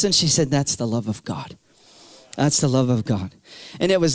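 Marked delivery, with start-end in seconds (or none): 0.5-3.3 much lower and softer, gentle reassurance; praying woman gently comforts the weeping teen; tender answer. Retold or imitated. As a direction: say it low and tender.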